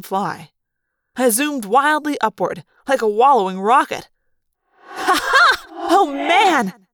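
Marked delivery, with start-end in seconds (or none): 4.8-6.9 very high, loud whoop of joy; exultant laugh. Acted. Scene: Rachel flying as a fly again, exultant laugh. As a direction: laugh out in sheer delight. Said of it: "Rachel exulted".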